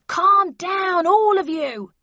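loud, high-pitched command; yelling. A narrator's reading. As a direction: shout down the whole room. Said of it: "yelled the bellman".

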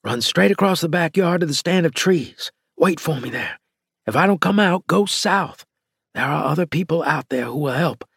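high-pitched urgent instructions; urging. A narrator's reading. Rescuer directing freed woman to safety, urgently. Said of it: "I urged".

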